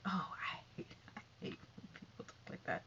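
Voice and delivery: normal talking voice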